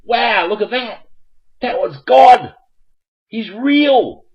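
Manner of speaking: amazed excitement. Real, not acted. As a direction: shout it out in disbelief and delight. Amazed God called.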